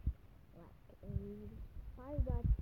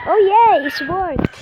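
loud, high victory whoop; excited cheer; 8 semitones higher, 13 dB louder than usual. Real, not acted. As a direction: shout it out in triumph. Puzzle solved, cheering.

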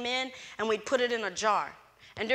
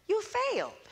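"You failed" said high and flatly factual; a blunt, factual verdict, voiced higher; matter-of-fact; 11 semitones higher, 4 dB quieter than usual. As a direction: state it plainly as settled fact. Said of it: "she said it so matter-of-factly".

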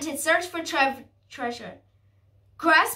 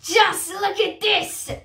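slow, raised pirate captain voice; bellowing; 4 semitones higher, 3 dB louder, slower than usual. A narrator's reading. Pirate captain laughing at the animal crew, bellowing. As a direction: bellow mockingly, with moderate force. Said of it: "he bellowed".

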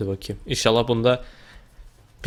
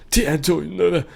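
higher, put-on announcer voice for the title; playful announcer; 5 semitones higher than usual. Shown as a demonstration. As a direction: announce the game name in a funny voice.

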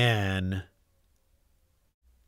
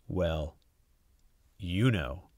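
softer, lower knowing hint; knowing hush; 5 semitones lower, 6 dB quieter, slower than usual. A narrator's reading. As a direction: trail off meaningfully. Hinting at tummy troubles.

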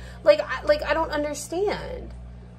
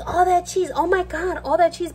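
higher, mocking "all that cheese" gasp; mock shocked gasp; 6 semitones higher than usual. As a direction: parody people gawking at the cheese. Mocking everyone's shocked cheese reaction.

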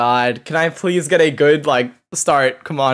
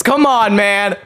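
high, frustrated pleading; exasperated plea; 6 semitones higher than usual. Real, not acted. Gamer with thirty seconds left, exasperated plea.

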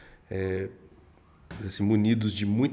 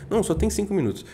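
high-pitched imitation of a rushed client; agitated client; 10 semitones higher, 3 dB louder, faster than usual. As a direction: mimic a client pressed for time, voice pitched up. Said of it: "o cliente chega agitado". (imitando cliente agitado e apressado)

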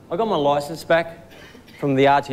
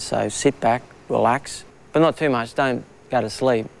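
ordinary talk, nothing marked

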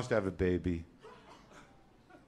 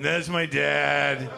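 put-on slow, higher, heavy kid voice; sad kid; 6 semitones higher, 8 dB louder, much slower than usual. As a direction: answer slowly like a weary child. Kid answering slowly.

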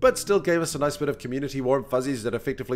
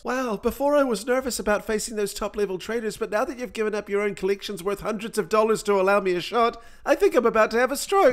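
high, nervous overwhelmed voice; panicked girl voice; 7 semitones higher than usual. Pretend. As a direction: voice her rising panic, higher and higher. Voicing Ava's panic, pitch up.

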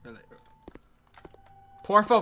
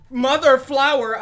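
startled exclamation; 7 semitones higher, 9 dB louder than usual. Real, not acted. Startled exclamation in horror game.

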